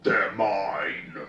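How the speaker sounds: loud greedy orc yell; orc nob scream. A narrator's reading. Orc nob claiming the marines as his, loud yell. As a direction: claim the kill with a loud roar. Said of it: "it screamed".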